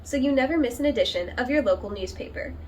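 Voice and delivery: loud, upbeat announcer read of the show intro; news intro read. Acted. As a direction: open the show like a local TV host. Host reads show intro.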